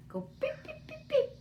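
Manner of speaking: high squeaky parrot beeps; imitated beeping. Shown as a demonstration. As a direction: squeak the beeps like the bird would. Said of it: "You wanna say hi?".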